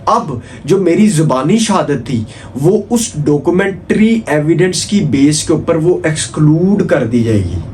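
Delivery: ordinary talk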